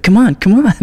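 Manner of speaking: high loud pleading; eager rat voice. Retold or imitated. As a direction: beg eagerly in a squeaky voice. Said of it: "It's like".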